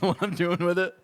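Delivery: ordinary talk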